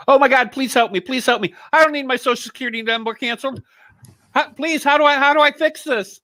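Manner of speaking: high, hammed-up desperate begging; mock panicked pleading. Pretending to beg a scam caller for help, mock panic.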